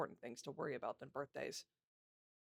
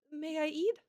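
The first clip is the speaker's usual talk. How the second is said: put-on high, eager little girl's question; excited; 11 semitones higher, 6 dB louder, slower than usual. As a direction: ask it bursting with eagerness. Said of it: "excitedly looking at her knight".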